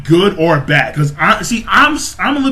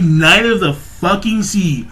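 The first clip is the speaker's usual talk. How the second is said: ordinary talk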